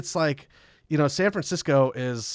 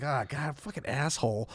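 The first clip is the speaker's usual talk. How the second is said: softer grumbled curse under the breath; muttered curse; 9 dB quieter than usual. Retold or imitated. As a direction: grumble it to yourself. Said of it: "we're just like".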